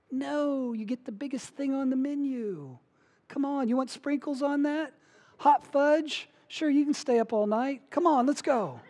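put-on high, eager, spoiling the kids with treats; overindulgent dad. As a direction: gush like a pushover dad who says yes to everything. Pastor plays indulgent dad, "That's me.

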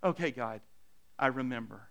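low, soft self-reassurance; subdued prayer. Acted. Preacher reassuring himself before God, subdued.

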